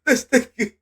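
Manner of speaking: high, loud laughing disbelief; wheezing through a laugh. Real, not acted. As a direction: crack up at the outburst. Laughing reaction to clip.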